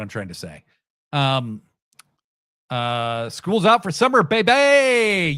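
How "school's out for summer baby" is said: loud, whooping summer's-out cry; gleeful cheer. Retold or imitated. Reading a chatter's cheer to the stream, loud and whooping. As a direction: belt it like the last bell just rang.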